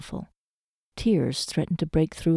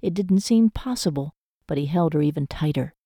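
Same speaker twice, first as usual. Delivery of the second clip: plain reading voice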